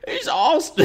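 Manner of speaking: very high put-on old man, breaking into laughter; Santa voice. YouTuber retrying the requested Santa, high and giggly. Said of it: "how would Santa sound?".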